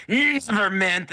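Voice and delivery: high, loud, drawn-out cry; screaming creature. Acted. Tortured rat to Myrna, high drawn-out scream. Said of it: "It's screaming in pain".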